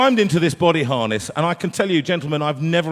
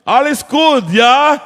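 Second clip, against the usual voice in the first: loud, high German shout; 9 semitones higher, 8 dB louder, slower than usual. Retold or imitated. Technician shouts up to the singer in the rig, loud and high. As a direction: bellow it up from below. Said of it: "they shout up".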